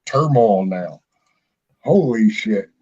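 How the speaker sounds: normal talking voice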